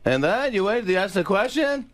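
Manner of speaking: high rising questions building to a slow yell; Jerry Seinfeld. Shown as a demonstration. Guest demonstrating the different Jerrys, high building impression. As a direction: pitch it up, then shout the last line. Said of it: "There's three different Jerrys".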